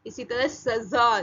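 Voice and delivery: सजा said slowly with a clear z; nukta z sound. Shown as a demonstration. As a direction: stretch सजा out and voice the z. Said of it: "नुक्ता लगा है तो सजा होगा".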